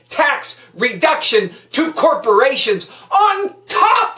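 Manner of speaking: loud, high, furious tirade at the politicians; angry rant. Real, not acted. Podcast host ranting at listeners about the budget, loud and furious. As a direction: hammer every line home with real anger.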